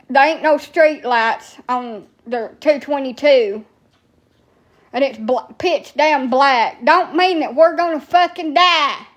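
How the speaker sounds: usual speaking voice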